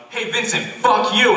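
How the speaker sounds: high, loud mock insult; shouted mock abuse; acted heckling shout. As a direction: call it out like a heckler, high-pitched.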